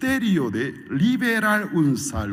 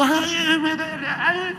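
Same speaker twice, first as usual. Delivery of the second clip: high, whiny pained voice; hammed-up high whine; mock complaining in pain; slower than usual. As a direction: whimper at the needle pricks. Pained whining.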